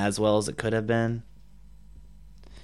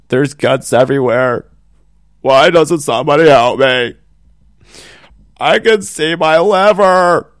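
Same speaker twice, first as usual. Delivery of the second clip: screaming in pain, building louder and higher; shouting while wounded; 6 semitones higher, 14 dB louder than usual. Acted. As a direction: cry out as if gutted, rising to a shriek. Mock gory book victim scene.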